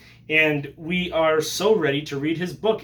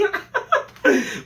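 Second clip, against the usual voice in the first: cracks up in a high giggle; high burst of laughter; 11 semitones higher, 3 dB louder than usual. Real, not acted. Vlogger laughing at his own Italians joke, high giggle.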